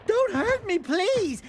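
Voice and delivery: mock pleading. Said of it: "They'd be all".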